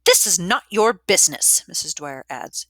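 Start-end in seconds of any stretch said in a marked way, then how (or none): 0.1-1.7 loud, higher, emphatic scolding; angry rebuke. A narrator's reading. Matron rebuking the interfering sister, loud and angry. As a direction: put the meddler down forcefully. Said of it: "turns her angry face back".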